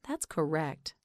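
usual speaking voice

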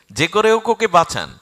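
high, loud repeated plea; desperate appeal. Acted. Hanuman begs for Arjun's life, high desperate appeal. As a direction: cry out for a life to be saved.

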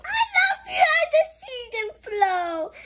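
high, loud excited read; child reading with high excitement. Acted. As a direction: squeal the line out. Reads line in squealy voice.

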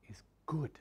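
breathy hush on "is good"; whispered. Real, not acted. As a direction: drop to a whisper to make them lean in. Hushed climax of reassurance.

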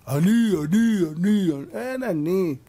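loud insistent me-me-me; mock boastful ego. Preacher mimicking a self-important man, loud and boastful. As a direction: parody a man full of himself.

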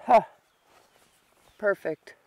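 ordinary delivery

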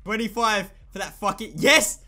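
hyped shouting; excited cheering. Real, not acted. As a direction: cheer the streaks on. Kill streaks coming in.

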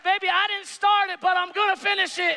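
retold cheer at daughter's graduation; proud dad yell. Dad shouting back to daughter's fan club, playful. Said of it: "I said".

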